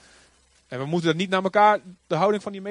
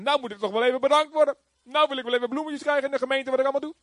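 mock entitled whine; 6 semitones higher, faster than usual. Preacher acts entitled servant.

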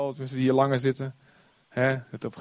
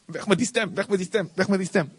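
a higher, dismissive weg met die stem; pushing God's voice away with a higher voice; mock dismissive younger self; 6 semitones higher than usual. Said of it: "En ik letterlijk zo deed".

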